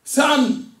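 loud, high cry to a son; forceful call. Acted. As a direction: call your son sharply, pitched right up. Father calls his son more forcefully.

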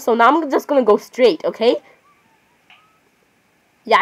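normal talking voice

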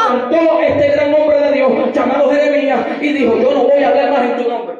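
loud, driving sermon delivery; fiery preaching. Acted. Preacher telling the church about Jeremiah, full-force preaching.

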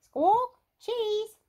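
very high, loud parrot squawk; piercing parrot echo; copying parrot. A narrator's reading. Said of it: "Polly Parrot copied".

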